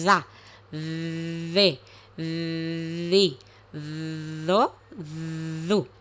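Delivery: za, ze, zi, zo, zu with the tongue between the teeth. Shown as a demonstration. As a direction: say each syllable za, ze, zi, zo, zu slowly with the tongue between the teeth. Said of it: "metiéndole las vocales".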